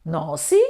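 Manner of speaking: Nosy said as "naw-see", with an s sound; mispronounced nausea. Shown as a demonstration. Teacher testing learners with a wrong reading, Nosy as "naw-see". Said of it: "it's not correct".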